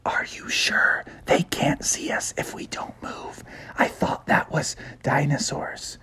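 a nervous, hushed question to a friend; whispered. Acted. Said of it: "Froggy whispered".

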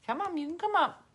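high coaxing pet talk; coaxing dog. Real, not acted. Calling the dog up to her, high coaxing.

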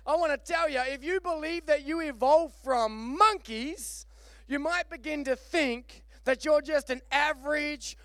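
high, loud preacher delivery; impassioned preaching. Real, not acted. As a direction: preach it hard, driving each phrase home.

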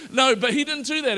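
ordinary delivery

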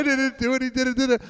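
high whiny imitation; mock girlfriend denial. Girl blaming the goofball to her bouncer boyfriend, high mock whine. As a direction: pitch it up like a girl denying blame. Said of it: "she was like".

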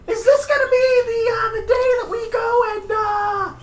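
high, loud squeaky goblin; shrill creature voice, pushed loud. Acted. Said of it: "in that voice you're speaking in Gobber".

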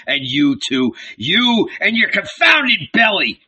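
lightly voiced zealot's threat; fiery prophet Andrew. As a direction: warn of doom with rising force.

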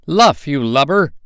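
put-on high, loud Irish-accented shout from Smee; Irish pirate's sharp high yell; Irish pirate cry. A narrator's reading. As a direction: bellow it like an Irish sailor. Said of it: "cried an Irish voice that was Smee's".

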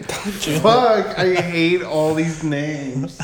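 high, loud curse, then slow grumble; loud frustrated curse, slowed complaint; frustrated outburst. Real, not acted. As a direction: vent about forgetting names. Frustrated at forgetting names.

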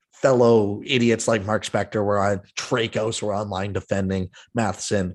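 ordinary talk

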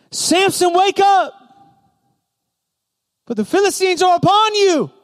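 high, urgent wake-up cry; calling out alarm. Acted. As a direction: cry out to rouse a sleeper. Delilah calls out to sleeping Samson.

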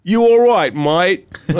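high, hollered mock mate-speak; douchey surfer dude. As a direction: call out as a clueless surfer bro. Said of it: "Det är såna här douchiga surfar".

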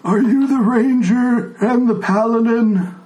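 the frail blind elder asking; old tiefling greets ranger and paladin, high old-man voice. Acted. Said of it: "And the old man says".

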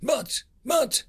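high faltering but but; flustered commander stuttering. A narrator's reading. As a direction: stumble over the words, lost. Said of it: "stuttered Carmack".

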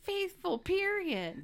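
high, emphatic insistence; mock indignation. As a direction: imitate an outraged spouse laying down the rule. Acting indignant husband's answer.

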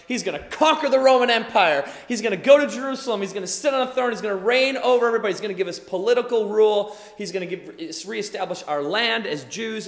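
excited. Retold or imitated.

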